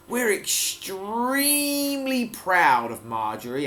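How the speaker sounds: lightly voiced farmer, slow and high; proud announcement. Farmer telling the gathered crowd, a lightly voiced proud announcement. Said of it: "announced the farmer to the crowd".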